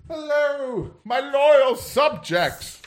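high, very slow royal proclamation; regal king. Acted. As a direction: address your subjects like a pompous monarch. GM voices the regal wrestler.

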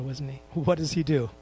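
normal talking voice

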